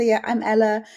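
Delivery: ordinary delivery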